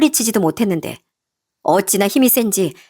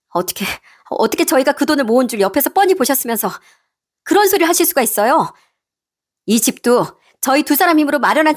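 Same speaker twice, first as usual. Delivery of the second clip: daughter-in-law arguing back, higher than the narration; indignant daughter-in-law; 4 semitones higher than usual. Acted. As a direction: stand up to your mother-in-law, indignantly. Daughter-in-law confronts mother-in-law.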